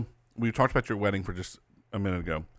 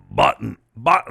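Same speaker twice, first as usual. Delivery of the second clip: loud, exaggerated New York accent; Dennis Basso; 10 dB louder than usual. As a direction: mimic a raspy New Yorker, much louder. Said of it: "and he says".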